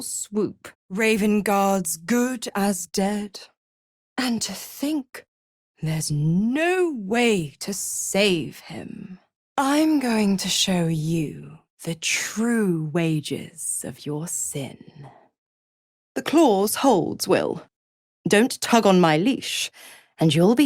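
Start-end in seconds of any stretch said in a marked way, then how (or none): 5.8-14.8 slow, drawn-out menace; slow, deliberate promise of punishment; menacing threat. Acted. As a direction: stretch the threat out, dropping away at the end.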